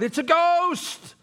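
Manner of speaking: high loud slow cry of fright; terrified cry. Acted. Preacher rereads the disciples' cry with a high loud fearful shout. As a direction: scream it out like you've seen a ghost. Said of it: "I didn't read that right".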